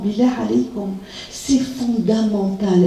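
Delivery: normal talking voice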